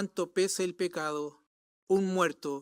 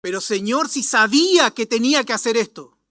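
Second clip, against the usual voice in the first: loud, higher-pitched appeal; anguished protest to God; 4 semitones higher, 8 dB louder than usual. Acted. Believer confessing failure to the Lord, anguished protest. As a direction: cry out to the Lord in regret.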